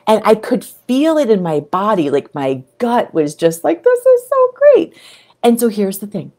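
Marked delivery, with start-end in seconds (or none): 3.8-5.0 high, gleeful squeal; delighted. Retold or imitated. Voicing her happy gut, a high delighted squeal. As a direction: let it burst out thrilled.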